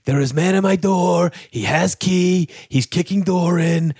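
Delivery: put-on Eastern European accent. Old man phoning police about a door-kicker, Eastern European accent. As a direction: report it in a put-on Slavic accent. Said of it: "like, a fake phone call".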